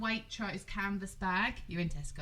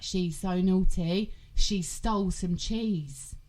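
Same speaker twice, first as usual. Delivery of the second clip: put-on explaining to a toddler; 2 semitones lower, 7 dB louder, much slower than usual. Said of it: "I was like".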